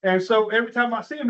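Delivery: usual speaking voice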